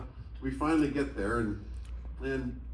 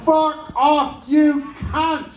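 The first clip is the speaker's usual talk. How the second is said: much higher and louder, hammed-up yell; mock furious shout; 14 semitones higher, 10 dB louder, much slower than usual. Drummer mock yelling at [name], hammed-up fury. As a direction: bellow the insult in cartoon rage.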